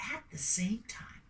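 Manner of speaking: whispered with suspense. Acted. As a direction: hush them before the twist. Building suspense for next twist.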